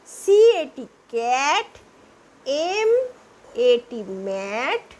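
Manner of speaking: each letter drawn out, then the word; slow spelling. Shown as a demonstration. Teacher drilling a-sound words, spelling slowly. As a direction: spell slowly so pupils can follow. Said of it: "ये होता है ए उच्चारण".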